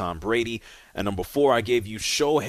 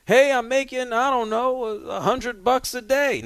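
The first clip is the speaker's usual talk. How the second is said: put-on much higher, excited voice of a kid with his first job; imitating a naive first-jobber, pitched way up; mocking naive new worker; 13 semitones higher, 4 dB louder than usual. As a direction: play the starry-eyed newbie bragging about his pay. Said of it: "the first thing you learn is".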